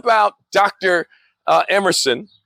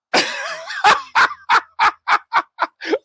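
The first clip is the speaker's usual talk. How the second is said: high laugh. Real, not acted. Laughs at the diet book.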